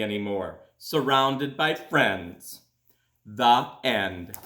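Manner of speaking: put-on gentle storybook reading for children; calm read-aloud to kids; story narration. A narrator's reading. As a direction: read it like a bedtime picture book. Reads a picture book aloud.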